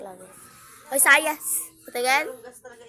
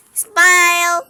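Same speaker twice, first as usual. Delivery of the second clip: loud coaxing; 4 semitones higher, 12 dB louder than usual. Real, not acted. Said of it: "Smile tayo, wait".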